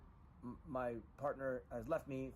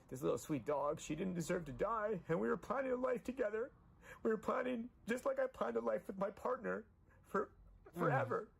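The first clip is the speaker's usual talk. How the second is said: grieving plea; 9 semitones higher, 6 dB louder than usual. Real, not acted. Emotional vow to dead dog.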